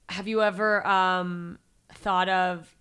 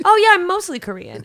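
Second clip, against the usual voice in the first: louder and higher mock-earnest claim; deadpan fib; 10 semitones higher, 8 dB louder than usual. Teasing strangers, mock-earnest claim to be Korean. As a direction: sell the lie cheerfully. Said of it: "just for fun, I'll be like".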